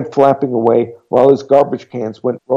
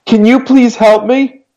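put-on slow, much higher, louder girlish plea; frightened girl's drawn-out plea; pleading girl; 13 semitones higher, 7 dB louder than usual. A narrator's reading. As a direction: beg for help through the door.

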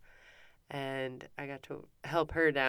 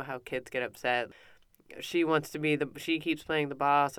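ordinary talk, nothing marked

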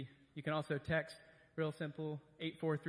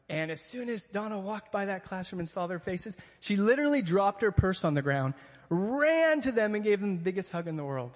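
excited; 4 semitones higher, 9 dB louder than usual. Real, not acted. Excited story of a hug.

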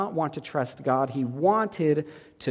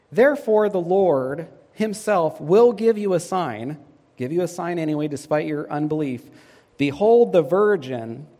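scripture reading; 5 dB louder than usual. Acted. Preacher reading Isaiah to the congregation, loud proclamation. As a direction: proclaim the prophecy to the church. Reads prophecy aloud.

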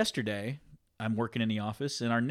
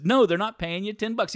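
higher, louder imitation of an insistent mother; retold motherly refusal; 7 semitones higher, 5 dB louder than usual. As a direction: put your foot down as the retold mom. Mother retold refusing son's demand.

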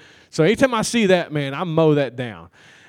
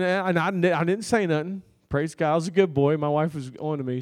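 usual speaking voice

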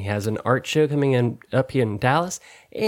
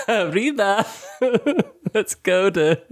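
giggly, higher-pitched mock Spanish; laughing; 6 semitones higher than usual. Real, not acted. Boasting of Duolingo Spanish to co-host, laughing throughout. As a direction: crack up between the words.

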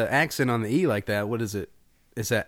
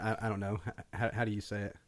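ordinary talk, nothing marked

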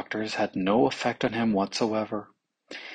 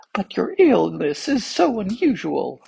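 a frightened physician, higher and louder; trembling; 9 semitones higher, 4 dB louder than usual. A narrator's reading. Physician answers the angry king, trembling and high. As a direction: plead nervously, pitched up high. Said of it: "in a trembling voice".

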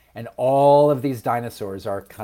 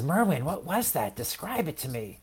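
hushed quote of a curious seed; lightly acted, softened little-seed voice; soft wondering question; 3 semitones higher, 11 dB quieter than usual. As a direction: ask softly, in wonder.

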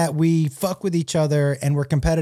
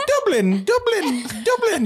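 rising, much higher mock "Dublin"; imitating Irish accent; 11 semitones higher than usual. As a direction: parody her strained accent, each "Dublin" higher. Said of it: "you're about to have a stroke".

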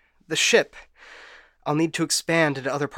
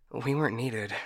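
soft, subdued and stunned; quiet realization; 7 dB quieter than usual. Acted. AI learning humanity healed itself, hushed realization. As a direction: let it sink in softly.

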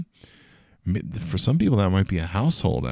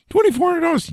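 much higher incredulous cry of the price; incredulous squeal; 4 dB louder, faster than usual. Real, not acted. Outrage at the phone price.

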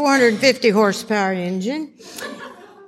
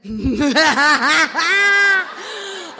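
gleeful whooping laugh; 3 semitones higher than usual. Real, not acted. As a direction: burst of triumphant laughter. Gleeful laugh after phone call.

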